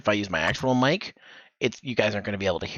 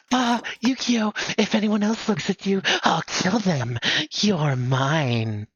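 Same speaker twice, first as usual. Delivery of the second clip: higher, sweetly threatening; possessive yandere; 9 semitones higher, slower than usual. Shown as a demonstration. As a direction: coo the threat at him. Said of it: "That's how I would do it anyways".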